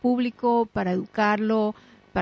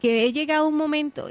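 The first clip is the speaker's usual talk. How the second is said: ordinary talk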